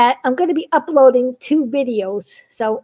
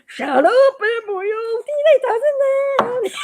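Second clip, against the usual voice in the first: high crying mock yell; whiny high yell; 9 semitones higher than usual. Astronaut Biggie yelling at Embryo, high whiny cry. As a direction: cry out, fed up with the little alien.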